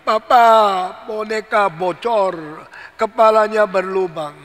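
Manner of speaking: mock wail, loud on "Papa"; crying child. Child reports broken doll to father, loud mock crying. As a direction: call out to papa like a bawling child. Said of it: "Sudah nangis setengah mati".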